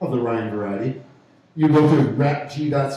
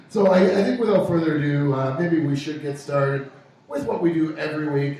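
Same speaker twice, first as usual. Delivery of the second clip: normal talking voice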